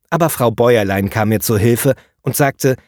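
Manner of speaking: ordinary delivery, nothing marked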